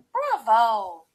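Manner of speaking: loud, triumphant exclamation; delighted cry. A narrator's reading. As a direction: cheer out loud in approval. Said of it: "she cried".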